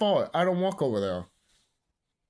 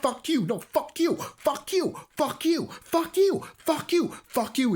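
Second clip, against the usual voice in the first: higher, hammed-up repeated curse; mock tirade; 9 semitones higher than usual. Vlogger cursing at critics, hammed-up rising tirade. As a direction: keep firing it, each higher. Repeated fuck you, escalating.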